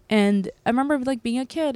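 normal talking voice

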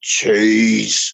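imitating a big tough winner; mock boom, one drawn-out word; booming deep voice. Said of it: "in this booming, deep voice".

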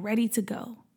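plain reading voice

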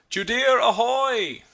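long, high, drawn-out ahoy; plaintive call from far across the dock; doleful hail. A narrator's reading. Captain hailing his ship from the middle of the dock, doleful. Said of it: "A doleful voice arose, hailing".

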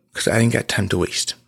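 usual speaking voice

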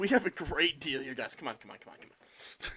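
low, very soft mock persuasion; hushed coaxing. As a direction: coax them along under your breath. US coaxing its cousins along.

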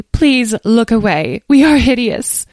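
high, slow, woeful fairy lament; sad fairy. A narrator's reading. Muddy fairy begs visitors not to look, sadly. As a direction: wail it mournfully as a vain fairy. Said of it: "said one sadly".

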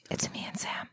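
creepy unvoiced whisper. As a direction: whisper it like a lurking bad guy. Creepy joke about bad guys.

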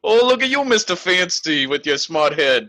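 high, drawn-out mock Bronx teasing; comedy Bronx accent. Professor teasing Mister Fancy expert, comedy Bronx accent. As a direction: razz them in a hammed-up Bronx accent. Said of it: "Bronx accent or, like, some kind of working class accent".

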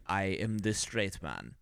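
low, slow mock Russian accent; bad Russian accent. Mocking the actor as too straight, bad Russian accent. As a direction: put on a heavy, slow Russian accent, softly. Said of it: "he has the terrible Russian accent".

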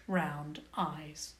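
usual speaking voice

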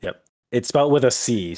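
usual speaking voice

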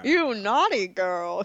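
put-on slow, drawn-out old film-star drawl; Jimmy Stewart. Joking about a late actor in the role, drawling impression. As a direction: stretch it out with big swooping pitch. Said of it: "I'm picturing that voice".

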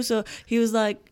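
ordinary delivery

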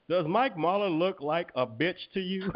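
slow, high menacing mock line; Samuel Jackson quote. Grilling a YouTube troll, slow mock menace. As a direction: lean in slow like a movie hitman.

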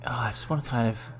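barely-voiced whisper to himself. Real, not acted. Guest on the spot whispering while he stalls. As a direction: mutter it under your breath, stalling.

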